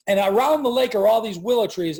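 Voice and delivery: ordinary talk, nothing marked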